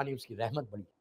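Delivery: soft, low answer; hushed realization. Acted. The sinner quietly admits that mercy is greater.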